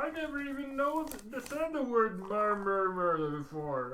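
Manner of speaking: a cheerful caged supervillain; Bull's voice. Acted. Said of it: "Hey, this is Bull".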